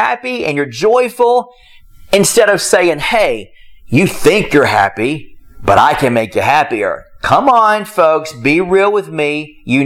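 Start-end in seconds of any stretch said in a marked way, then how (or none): 3.0-7.0 lower, slow, condescending quote; smug put-down. Retold or imitated. Preacher mocking a judgmental churchgoer, smug and slow. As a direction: talk down to them like you know better.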